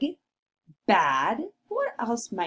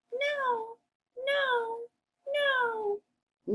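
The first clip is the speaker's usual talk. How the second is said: very high squeaky no; shrill it like a tiny pig; 5 dB quieter, much slower than usual. Acted. Pigs refuse.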